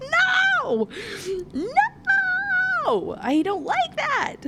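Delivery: very high, drawn-out wail of protest; squealed protest. Real, not acted. Reader overwhelmed by the mating bond line, squealing. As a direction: wail it way up high, stretching the words. Overwhelmed reaction to mate confession.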